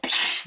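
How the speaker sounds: puffed mouth-made shot noise; airy "pfft" gunfire effect; imitated gunshot. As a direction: blow a quick shot sound.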